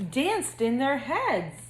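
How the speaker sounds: usual speaking voice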